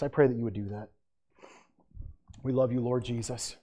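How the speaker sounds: hushed pastoral prayer; closing prayer. Acted.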